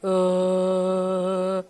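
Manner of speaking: long low drone of "uh"; imitated bored groan. Imitating listeners unimpressed by the air museum, droning groan. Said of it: "I can see some of you going".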